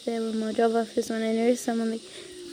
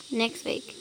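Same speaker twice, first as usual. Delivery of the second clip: normal talking voice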